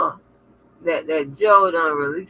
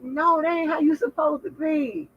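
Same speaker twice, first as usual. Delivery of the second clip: friend correcting; 11 semitones higher than usual. Retold or imitated. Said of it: "She's like".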